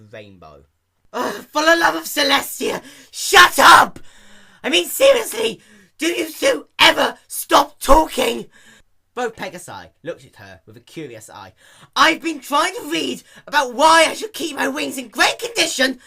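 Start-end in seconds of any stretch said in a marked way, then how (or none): 1.1-8.5 high, loud exasperated yelling; screaming in total exasperation; furious shout. Acted. Dash yelling at chatty pegasi. 12.0-16.0 high, loud ranting shout; yelling about the endless yapping; angry rant. Acted. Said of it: "Dash continued".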